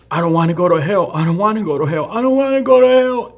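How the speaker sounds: high pleading, rising to a near cry on the last; the last words, begged in fear; terrified plea. Retold or imitated. Dying friend's last words to narrator, rising terrified plea. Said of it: "He said".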